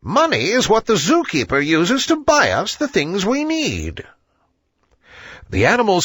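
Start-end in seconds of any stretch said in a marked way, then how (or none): 0.0-4.0 much higher owl voice explaining patiently; a wise old bird spelling it out; lecturing owl. A narrator's reading. Owl explains jobs to the animals, higher and instructive.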